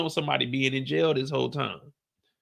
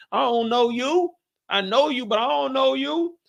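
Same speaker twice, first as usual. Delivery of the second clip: suspicious brush-off; 11 semitones higher than usual. Retold or imitated. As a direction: keep them at arm's length. Refusing family at the door.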